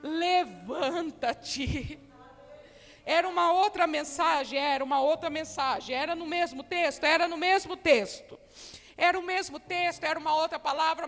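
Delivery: preaching. Acted. Preacher calling the women to rise, preaching loudly. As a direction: call the women to stand.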